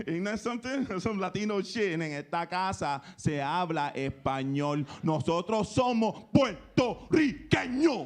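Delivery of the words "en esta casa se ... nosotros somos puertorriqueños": slow, proud Spanish father's decree; mock strict dad. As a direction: lay down the law like an old-school dad, slowly.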